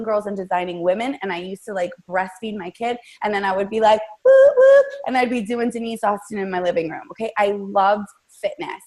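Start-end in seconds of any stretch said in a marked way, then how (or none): 4.2-4.9 loud, high double whoop; excited "whoop, whoop"; whooping cheer. Retold or imitated. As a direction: let out two high workout whoops. Retold cheering at workout.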